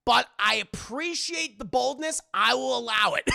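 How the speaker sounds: booming ruling from the bench; loud judge ruling. Retold or imitated. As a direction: declare it like a judge. Judge rules in court.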